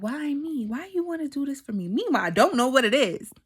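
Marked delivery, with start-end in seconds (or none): 0.0-1.9 mildly imitated plea, pitched high; disbelieving plea. Asking God about the feeling, retold disbelieving plea. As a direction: question it in a high, wondering voice. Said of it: "and I said".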